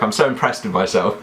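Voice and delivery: normal talking voice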